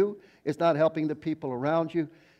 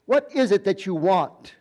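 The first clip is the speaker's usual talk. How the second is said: louder, higher, exasperated repeat of the question; getting mad; 5 semitones higher, 7 dB louder than usual. Retold or imitated. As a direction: demand an answer from your brother, fed up. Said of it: "I was getting so mad at him".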